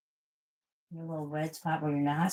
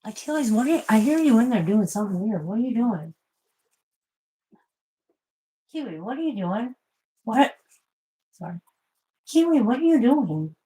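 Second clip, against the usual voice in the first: higher, louder call to the cats; calling the pets; 5 semitones higher, 5 dB louder than usual. Real, not acted. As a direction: call them from the next room.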